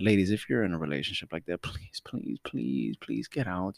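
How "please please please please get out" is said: soft, much quieter, begging; hushed pleading. Real, not acted. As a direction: beg them gently to leave. Pleading to women in bad relationships.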